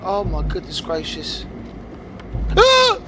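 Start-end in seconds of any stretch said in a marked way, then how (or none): 2.5-3.0 short high loud scream of fright; startled scream. Real, not acted. Gamer jump-scared by a shadow, loud high scream.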